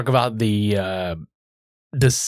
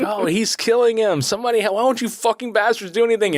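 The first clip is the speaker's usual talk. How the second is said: mild mock scream; 9 semitones higher than usual. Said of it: "she's screaming bloody murder".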